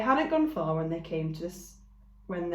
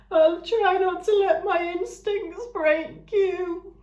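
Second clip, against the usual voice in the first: high, slow, tearful ram; sniffly ram voice; 12 semitones higher, 7 dB louder, slower than usual. Acted. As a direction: promise through sniffles. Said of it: "the ram sniffled".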